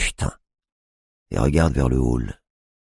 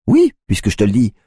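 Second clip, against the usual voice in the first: insistent; 8 semitones higher, 6 dB louder than usual. A narrator's reading. Tiffany insists.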